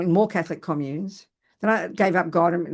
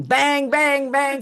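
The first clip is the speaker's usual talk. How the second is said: imitated banging; 5 semitones higher, 4 dB louder, much slower than usual.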